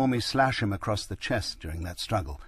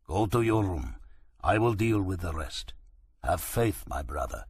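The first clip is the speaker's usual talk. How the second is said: put-on a light Turkish accent, calm. A narrator's reading. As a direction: reassure him in a Turkish accent. Driver parts, accented.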